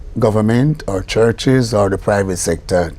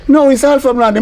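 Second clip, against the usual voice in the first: high, louder mock insistence; insisting back; 13 semitones higher, 4 dB louder than usual. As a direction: she insists he is wrong. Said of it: "She say".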